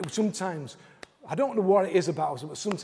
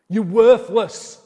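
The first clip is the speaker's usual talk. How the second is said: much louder, slow shouted insult; shouted lie; 4 semitones higher, 13 dB louder, much slower than usual. Acted. Preacher shouts the enemy's lie to the congregation, loud and slow. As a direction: shout it slowly so the back row hears. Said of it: "I shouted that".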